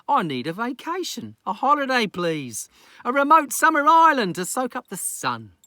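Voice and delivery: wife declaring she's off on holiday; Lucy, excited. Acted. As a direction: announce the trip with gusto. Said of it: "he smiled and waved and said".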